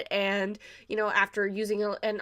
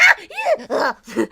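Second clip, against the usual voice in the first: alarmed yelp; 10 semitones higher, 9 dB louder, slower than usual. Retold or imitated. Reacting to Eugeo getting injured.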